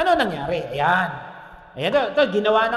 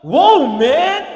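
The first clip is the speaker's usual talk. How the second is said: loud, higher, wide-eyed wow; amazed exclamation; 5 semitones higher, 11 dB louder than usual. Acted. Adam seeing Eve for the first time, loud amazed wow. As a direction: gasp it out in awe at the first sight of her. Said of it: "bakit woman?".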